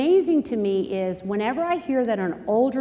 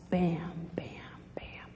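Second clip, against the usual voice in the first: low, hushed thudding whisper; whispered heartbeat; 6 semitones lower, 14 dB quieter than usual. Acted. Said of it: "the beat of their own lust".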